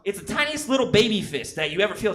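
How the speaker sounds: normal talking voice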